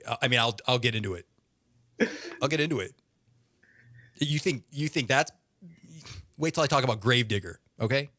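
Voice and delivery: ordinary talk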